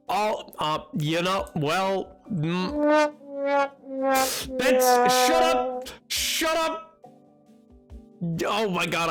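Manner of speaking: ordinary talk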